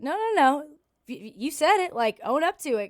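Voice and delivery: high, loud retold yell of no, no, no; retold shout at crowd. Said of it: "And I was like".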